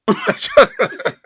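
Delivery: high, loud mock dismay; hammed-up wail breaking into laughter; Willy Wonka. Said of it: "he's like".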